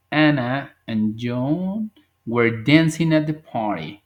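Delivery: spoken slowly, word by word; slow model sentence. Shown as a demonstration. (frase modelo despacio)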